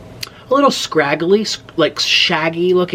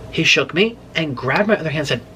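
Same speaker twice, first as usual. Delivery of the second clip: usual speaking voice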